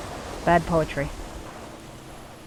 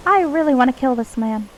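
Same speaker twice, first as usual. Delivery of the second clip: high pixie grumble; 6 semitones higher than usual. Acted. Player fed up with the rhyming wisp, high pixie voice. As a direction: pitch it up into a pixie's aside. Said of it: "pixie voice when I'm not in character".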